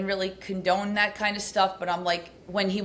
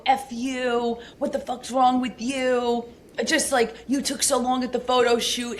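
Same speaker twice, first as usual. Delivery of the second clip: higher-pitched mock rant, building as it goes; retold screaming tirade; 5 semitones higher than usual. Retelling her boyfriend's abuse in the car, heated mock screaming. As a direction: imitate a drunk boyfriend's angry tirade, short of screaming. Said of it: "he just started screaming me in the car".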